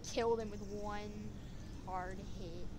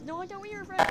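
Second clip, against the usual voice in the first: higher, louder comeback; indignant retort; 9 semitones higher, 6 dB louder than usual. Acted. Other toy throwing the accusation back, indignant. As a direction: fire it back in a raised, high voice.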